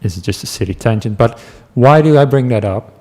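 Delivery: normal talking voice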